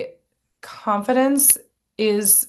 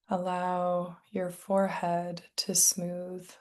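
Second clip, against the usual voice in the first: guided meditation; 7 dB quieter than usual. Real, not acted. Meditation teacher settling listeners into their bodies, soft guided meditation. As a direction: ease them gently into stillness, softly and unhurried.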